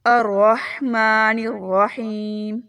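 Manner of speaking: slow drawn recitation of الرحمن الرحیم. Shown as a demonstration. Teacher reciting the verse for repetition. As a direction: chant it slowly and clearly. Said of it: "اس کو پورا پڑھتے ہیں".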